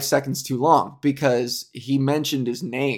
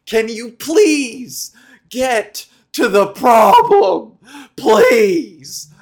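loud, drawn-out exasperated begging; fed-up viewer losing patience; exasperated plea; 7 semitones higher, 8 dB louder, much slower than usual. Retold or imitated. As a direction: plead loudly and slowly for them to get on with it. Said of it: "And you're like".

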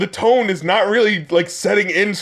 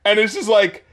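ordinary talk, nothing marked

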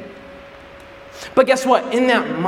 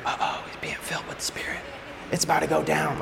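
much softer, breathy, fast voiced aside; hushed aside; 4 semitones lower, 11 dB quieter, faster than usual. John nudging Peter beside him, a hushed uh-oh aside. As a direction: murmur it like a nervous sidekick warning. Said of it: "It's like".

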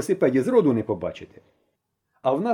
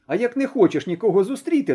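usual speaking voice

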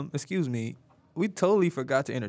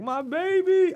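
mock crying dad; much slower than usual.

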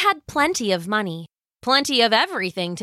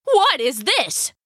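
surprised cry; 4 semitones higher, 3 dB louder, slower than usual. Acted. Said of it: "cried Mister Hacktack".

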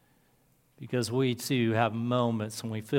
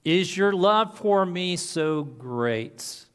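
slow emphatic question; 3 semitones higher, much slower than usual. Acted. Preacher slows for the climactic question.

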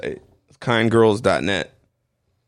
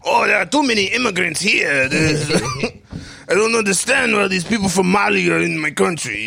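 bad French accent; 8 semitones higher than usual. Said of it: "My accents are so bad".

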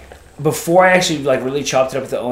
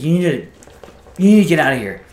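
imitating a flustered man, louder and higher; 5 semitones higher, 8 dB louder, faster than usual. Hotel owner telling the guest to leave, flustered. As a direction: stumble into a loud, flustered order. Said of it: "getting all flustered".

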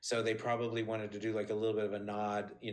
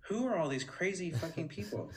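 put-on higher, incredulous little old lady; imitating Estelle, higher and disbelieving; Estelle impression; 7 semitones higher than usual. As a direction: play a bewildered tiny star, half amused. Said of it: "but then she'd say".

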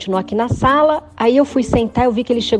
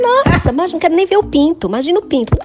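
nojo exagerado; 9 semitones higher, 4 dB louder than usual. Retold or imitated. Pensamento horrorizado sobre o encontro.

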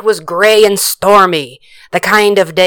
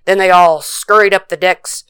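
usual speaking voice

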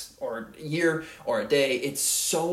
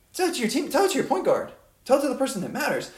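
raised high and louder, driving each tell; urgent emphatic call; 11 semitones higher, 4 dB louder, faster than usual. Real, not acted. Urging young players to speak up, in a high, loud, pressing voice. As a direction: hammer it home like a coach.

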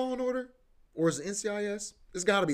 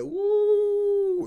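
excited whoop; 7 dB louder than usual. Real, not acted. Excited about that evening's show.

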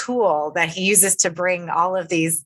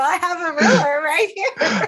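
high voice bubbling with laughter; high, laughing joke; laughing offer; 11 semitones higher than usual. Real, not acted. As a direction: say it through laughter, pitch way up. Joking offer amid shared laughter.

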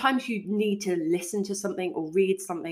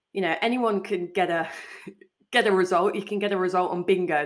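ordinary talk

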